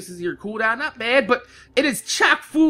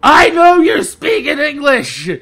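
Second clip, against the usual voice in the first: screaming at someone; 7 dB louder than usual. Retold or imitated. Half-deaf customer seeming to scream at the clerk, exasperated yelling. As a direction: yell each word in exasperation. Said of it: "He's screaming at the guy".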